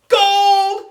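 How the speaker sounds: very high, loud squeal of a shout; piercing high holler; excited shout. A narrator's reading. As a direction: screech it out like an overexcited kid. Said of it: "shouted Neil the nude kid".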